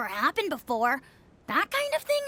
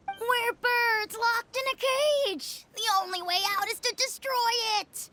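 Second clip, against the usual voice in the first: mocking quote; 6 semitones higher than usual. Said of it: "That's what you said".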